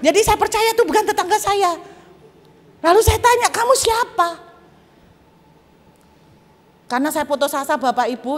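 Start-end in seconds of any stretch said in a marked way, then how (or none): 3.5-4.5 very high, strained demand; alarmed question. Retold or imitated. Asking the mystery voice who it is, high alarmed cry. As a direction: cry out to the unseen voice.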